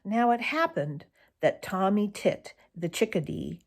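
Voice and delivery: ordinary delivery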